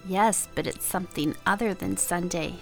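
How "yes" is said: usual speaking voice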